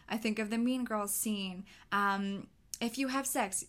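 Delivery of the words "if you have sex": a hint of a stern gym teacher, higher; lightly voiced gym coach warning. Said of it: "this is what the gym teacher says in Mean Girls".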